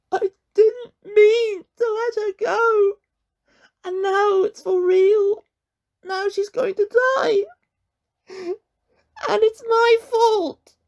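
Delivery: slow, high, wailing sobs; crying. A narrator's reading. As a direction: break down in tears. Connor breaks down crying.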